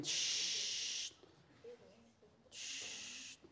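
soft unvoiced shh, fading away; teacher shushing the class quietly. Real, not acted.

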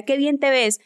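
voz más aguda de conocida entrometida; conocidos opinando. Retold or imitated. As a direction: imita a quien opina sin que le pregunten. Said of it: "había gente que me decía". (imitación aguda de la gente que opina sobre su cuerpo)

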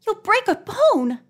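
ordinary delivery, nothing marked

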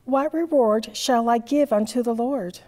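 usual speaking voice

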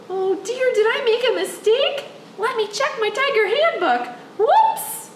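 high, fretful storyteller; mock worry. Storyteller checking tiger handbook, high mock worry. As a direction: fluster over a pretend blunder. Storyteller discovers own mistake.

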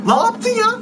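high, loud exclamation of disbelief; astonished outcry. Real, not acted. Gamer reacting to the pack reveal, astonished. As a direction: cry out in real shock.

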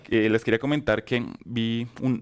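normal talking voice

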